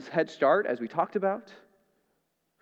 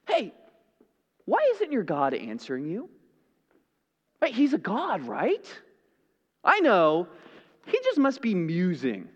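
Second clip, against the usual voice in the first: mocking. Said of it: "Elijah begins to mock them".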